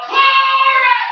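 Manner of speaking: high, loud, drawn-out cry of glory; shouted praise. Acted. Preacher voicing the creatures at the throne, shouted praise. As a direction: belt it out as heavenly worship. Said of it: "they would shout".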